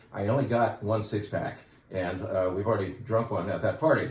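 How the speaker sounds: normal talking voice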